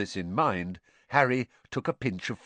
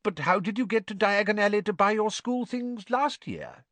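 higher curious voice; higher wondering wizard dad; Mr Weasley, curious; 12 semitones higher than usual. A narrator's reading. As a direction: ask with fascinated interest. Mr Weasley asking Harry.

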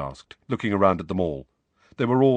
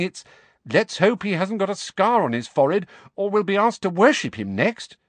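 put-on much higher girl's voice, quick; Hermione, indignant; 12 semitones higher, 4 dB louder than usual. A narrator's reading. Hermione tells Harry the jibes, high and indignant. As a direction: Hermione reporting the sneers.

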